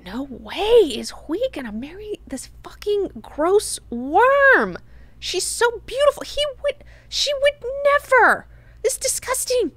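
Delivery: high-pitched, hammed-up disbelief and disgust; appalled outburst. Retelling Duncan's reaction to the wedding, appalled. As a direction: squeal it in mock horror, stretching key words.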